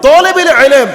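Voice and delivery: high, loud fiery sermon; preaching at full force. Real, not acted. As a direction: preach it with rising fervour to the crowd. (জোরালো উচ্চস্বরে ওয়াজ)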